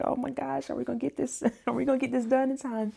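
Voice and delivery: high, soft worried fretting; retold mild panic. Cook fretting to her helper about the deadline, mild panic. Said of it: "I had a few moments where".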